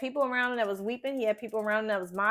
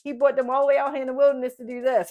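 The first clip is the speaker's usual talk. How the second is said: quick, louder taunting voice; mocking onlooker; 2 semitones higher, 7 dB louder, faster than usual. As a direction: sneer it out like a jeering bystander. The Egyptians mocking Moses.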